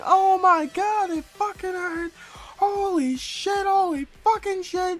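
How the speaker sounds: very high, drawn-out wail of pain; hammed-up screaming freak-out; mock screaming. Kid who hurt his own head, mock screaming in pain.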